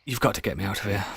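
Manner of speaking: quick, hushed desperate plea; panicked pleading. Pretend. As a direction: beg in a rush under your breath. Pleading prisoner, fast and quiet.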